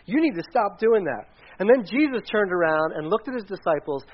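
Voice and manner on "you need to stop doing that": higher-pitched, exasperated scolding; reproachful protest. Acted. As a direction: challenge him, insisting he stop. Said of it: "And so Peter says".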